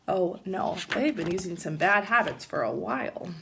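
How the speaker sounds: plain reading voice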